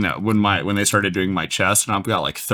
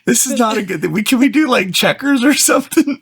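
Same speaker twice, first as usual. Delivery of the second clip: pitched way up and pleading; whiny high plea; 13 semitones higher, 4 dB louder than usual. Real, not acted. Pleading with her co-host to play checkers instead, high whiny voice. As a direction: beg to swap games in a high whine. Pleading to swap the bad questions.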